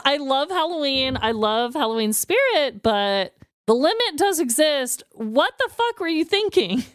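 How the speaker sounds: ordinary delivery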